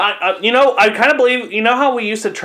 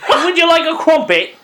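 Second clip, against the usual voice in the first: higher, cartoonish British lady accent; lightly hammed British accent; mock British accent; 9 semitones higher, 3 dB louder than usual. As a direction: play a prim British lady offering tea. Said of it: "a British lady who would talk like".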